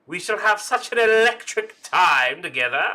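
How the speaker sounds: high, loud theatrical delight; effusive dean. Pretend. Dean promises the new student an electric time, effusive. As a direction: gush grandly to the newcomer.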